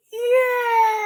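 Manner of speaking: high, bright yay shout; high cheer. Acted. Cheering after exercise.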